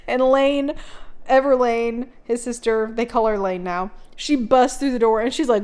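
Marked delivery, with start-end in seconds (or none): none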